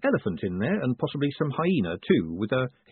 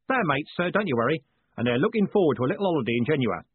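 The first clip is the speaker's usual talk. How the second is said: higher-pitched roll-call of mates; proud coachman; 5 semitones higher than usual. Acted. Coachman vouching for his guards, high proud voice. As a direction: list the names with a driver's relish.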